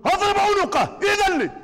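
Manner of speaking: high, loud angry demand; the preacher voices Omar asking leave to strike; Omar's shout. Retold or imitated. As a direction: bellow it like an outraged companion. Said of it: "سيدنا عمر يقول له".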